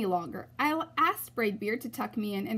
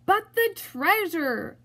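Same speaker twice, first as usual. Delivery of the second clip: high, slow dismayed holler; 8 semitones higher, 5 dB louder, slower than usual. A narrator's reading. Captain hollering about his treasure, high and slow. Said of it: "hollered Braidbeard".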